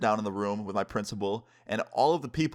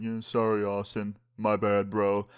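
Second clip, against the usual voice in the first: mumbled mock apology, barely trying; half-assed apology; slower than usual. The jumpers forced to apologize to their victim, half-assed. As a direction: imitate guys grudgingly saying sorry. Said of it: "the most half-assed apology".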